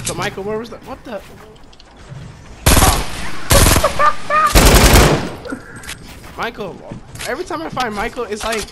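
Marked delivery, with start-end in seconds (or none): 1.3-6.3 high loud cackling laugh; shrieking laugh. Real, not acted. Screaming laugh.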